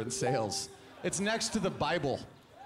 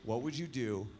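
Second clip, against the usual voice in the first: lower, softer deadpan buddy; buddy asking; 6 semitones lower, 5 dB quieter than usual. Retold or imitated. Friend asking [name] a dumb question, low and deadpan. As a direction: pose the question low and serious. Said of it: "one of my friends was like".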